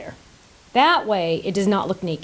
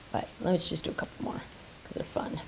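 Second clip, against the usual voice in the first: soft whisper, almost no voice; whispering to viewers; whispered aside; 6 semitones lower, 13 dB quieter than usual. Real, not acted. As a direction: hush it, like sharing a secret. Hushed aside before more whooshes.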